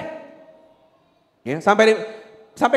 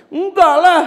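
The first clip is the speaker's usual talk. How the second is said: loud, high mock protest; indignant refusal; 9 semitones higher, 6 dB louder, slower than usual. Child rejecting a month's ban, loud imitated protest.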